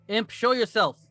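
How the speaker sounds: loud, high commanding call; commanding shout. Acted. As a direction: order the creature out, loudly. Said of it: "and he'll be like".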